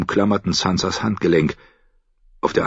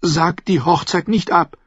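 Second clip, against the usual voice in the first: higher-pitched, urgent pleading; blurted plea; 11 semitones higher than usual. A narrator's reading. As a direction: plead like a frightened girl. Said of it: "platzte sie heraus".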